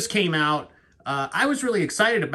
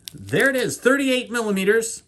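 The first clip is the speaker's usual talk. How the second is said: excited; 9 semitones higher, 5 dB louder than usual. Real, not acted. Showing watch size.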